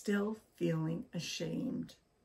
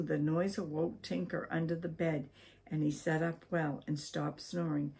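usual speaking voice

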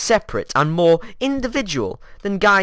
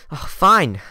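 ordinary talk

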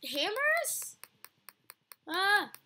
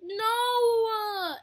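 high, loud, drawn-out cry; dismayed wail; 5 semitones higher, 6 dB louder than usual. Real, not acted. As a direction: wail as it all goes wrong. Fails in the game.

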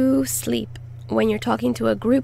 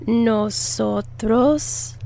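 nosotros said very slowly, then limpiamos; slow broken-up model; syllable by syllable. Shown as a demonstration.

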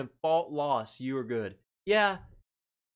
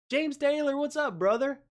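excited greeting; 11 semitones higher than usual. Real, not acted. Host greets viewer James in chat, excited hail.

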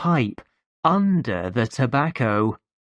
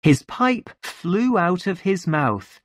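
plain reading voice

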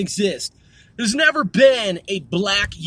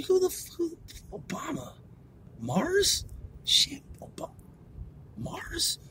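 very soft, higher, halting whisper; retold puzzled whisper; 6 semitones higher, 13 dB quieter than usual. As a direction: act out puzzling it over in a whisper.

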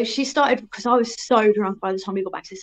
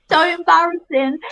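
laughing through the words, a little higher; laughing embarrassed; 7 semitones higher, 5 dB louder than usual. Real, not acted. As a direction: giggle it out, cringing at the memory. Laughing at her own memory.

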